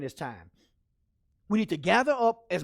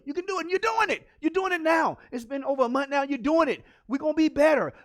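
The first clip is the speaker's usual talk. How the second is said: high, insistent pep talk; rally them like a coach; fired-up encouragement; 8 semitones higher, 4 dB louder than usual. Real, not acted. Cheering on the viewers.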